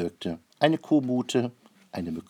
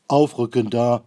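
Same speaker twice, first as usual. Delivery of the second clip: loud marshalling shout; commanding call; 3 semitones higher, 11 dB louder than usual. A narrator's reading. Noah orders boarding animals to move up, shouting loudly. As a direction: call the order out over a crowd.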